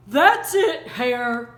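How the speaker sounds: loud angry bear holler. A narrator's reading. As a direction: holler at the tricky hare. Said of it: "he hollered".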